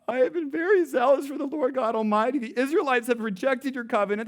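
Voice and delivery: high, loud, tearful whine; whiny voice. Retold or imitated. Elijah whining to God, high and tearful. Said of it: "Elijah in that moment brings out his whiny voice".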